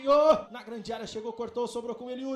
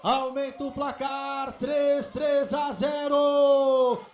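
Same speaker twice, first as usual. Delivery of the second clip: goal scream; slower than usual. Real, not acted. Third goal.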